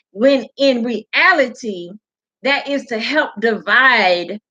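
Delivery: normal talking voice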